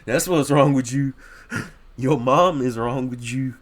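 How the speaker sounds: loud, drawn-out taunting jab at the screen; mocking retort. Reacting to the clip, hurls a mocking your-mom taunt. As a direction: jeer back at him like a schoolyard comeback.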